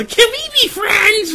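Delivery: put-on high, loud, slow child squeal; little kid voice. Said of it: "And it was".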